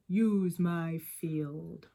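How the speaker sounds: put-on very slow, low, steady old farmer. Farmer Seed offers his field to the village, slow and low. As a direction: offer it like a calm country farmer. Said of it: "and Farmer Seed said".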